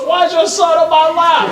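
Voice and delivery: high-pitched mock protest; complaining senior. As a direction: demand an answer as the put-upon teenager. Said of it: "He goes".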